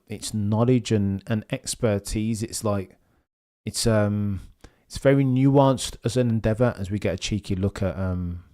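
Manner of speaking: ordinary talk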